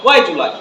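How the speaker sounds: "Why do you lie" as quick why-julai; native linking. Shown as a demonstration. Teacher confirms the native way, linked "Why do you lie". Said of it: "এরকম বলে".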